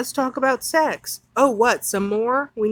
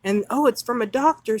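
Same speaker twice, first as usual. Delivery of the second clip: ordinary delivery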